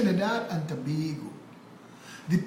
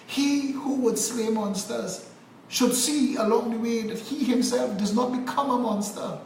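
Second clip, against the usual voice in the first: ordinary talk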